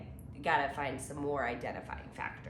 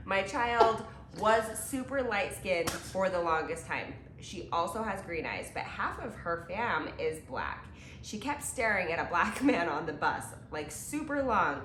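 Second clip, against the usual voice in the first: ordinary delivery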